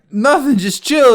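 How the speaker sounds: high, loud, drawn-out placating; strained retold reassurance. Man reenacting calming his drunk friend, strained placating. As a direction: stretch it out to hold someone off.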